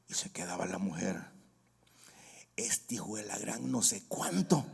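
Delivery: whispered grumble, half to herself; muttered under breath. Retold or imitated. Wife grumbling about her husband, whispered. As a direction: mutter it low like a fed-up wife.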